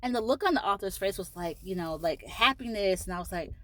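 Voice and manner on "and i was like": normal talking voice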